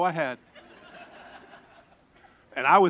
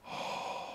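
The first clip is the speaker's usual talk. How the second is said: breathless relief gasp; 14 dB quieter than usual. Retold or imitated. Relief in the cave.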